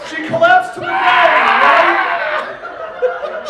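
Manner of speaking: high, loud laughing cry; laughing shout. Real, not acted. Laughing at the silly fanfic, a loud laughing shout. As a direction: shriek it through laughter.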